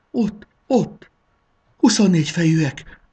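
high, frightened stage whisper; frozen whisper. A narrator's reading. Sarolta spots the twenty-four-headed dragons coming, frozen stage whisper. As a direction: whisper shrilly, petrified. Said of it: "suttogta dermedten".

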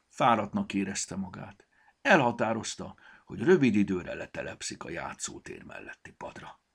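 plain reading voice

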